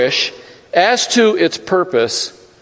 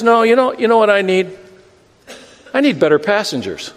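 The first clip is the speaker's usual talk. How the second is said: higher, whiny car voice making demands; complaining car; 5 semitones higher than usual. Acted. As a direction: grumble like a neglected old car. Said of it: "the car says".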